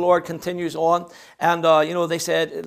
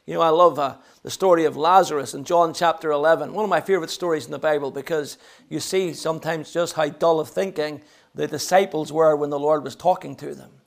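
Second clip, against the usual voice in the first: normal talking voice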